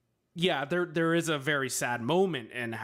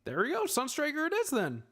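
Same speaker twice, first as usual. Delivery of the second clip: pleased decision; 14 semitones higher than usual. Retold or imitated. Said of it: "And I was like".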